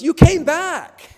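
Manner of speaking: put-on very high, drawn-out little-kid squeal; excited child. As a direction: shout it slowly like a thrilled small child. Excited daughter voiced in story.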